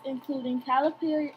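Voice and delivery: ordinary delivery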